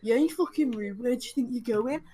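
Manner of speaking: mimicked aggressive comeback; confrontational man. Man challenges the departing passenger, mimicked confrontation. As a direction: throw back the challenge as the man. Quoting the man's reply.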